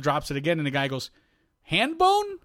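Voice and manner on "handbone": high, puzzled mishearing of Handbone; confused question. Retold or imitated. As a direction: ask back in disbelief, pitch way up. Coach mishears the nickname.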